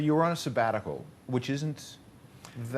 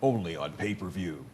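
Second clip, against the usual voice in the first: lower-pitched mock announcer; deep voice guy; 4 semitones lower than usual. Interviewer capping the guest's monster truck bit, low announcer impression. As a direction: imitate a deep event-promo voiceover. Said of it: "that deep voice guy that could go".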